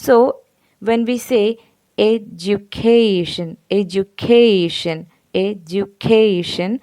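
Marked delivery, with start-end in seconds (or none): 2.0-6.7 "education" said slowly, leaning on the "ca"; primary stress on third syllable. Shown as a demonstration. Teacher showing students where stress falls, slow stressed "education". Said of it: "the primary stress falls on the".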